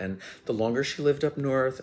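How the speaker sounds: ordinary talk, nothing marked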